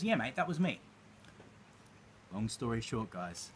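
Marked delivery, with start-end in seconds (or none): 0.0-0.8 put-on Australian accent. Businessman reveals it was him, light Australian accent. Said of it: "I'll try and do the accent as best I can".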